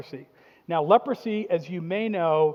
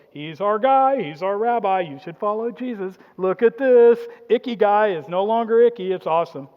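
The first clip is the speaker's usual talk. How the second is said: loud, thrilled cheering; stoked disciples; 2 semitones higher, 9 dB louder than usual. Acted. Disciples cheering their rabbi after the healing, loud and thrilled. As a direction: hype up your rabbi to the crowd.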